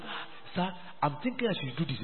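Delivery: tentative request. Acted. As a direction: sheepishly float an idea to your leader. Member timidly proposing to leader.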